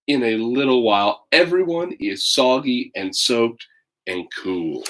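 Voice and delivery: put-on lively read-aloud for children; story narration. A narrator's reading. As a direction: tell it like a picture book to kids. Reads picture book aloud.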